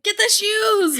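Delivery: high, loud, hammed-up alarm; a pretend frantic mom yelling about shoes; mock panic shout. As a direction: yell it like a fake emergency.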